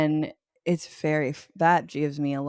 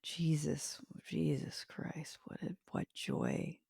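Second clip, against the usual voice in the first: hushed, whispered thinking aloud; stumped muttering; 14 dB quieter than usual. Real, not acted. Guest stumped by the eight ounces of joy question, muttering. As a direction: mutter it under your breath.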